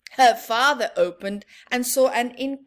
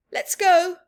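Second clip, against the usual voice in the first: put-on eager kid; 7 semitones higher, 8 dB louder than usual. Michael eager to leave.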